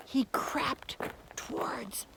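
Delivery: hushed, breathy narration; whisper. A narrator's reading. Narrating the prince's sneaking, whispered. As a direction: whisper it as he creeps.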